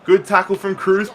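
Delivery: ordinary talk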